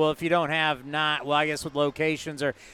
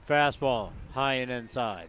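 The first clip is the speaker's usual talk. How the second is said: mock monotone; 6 semitones lower, 7 dB quieter than usual. Shown as a demonstration. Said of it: "It's very monotone".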